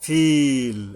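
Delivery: "ফিল" with the i held long; loud, drawn-out "ফিল"; long madd. Shown as a demonstration. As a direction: stretch the vowel of "ফিল" for three beats. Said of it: "তিন আলিফ টানতে হবে".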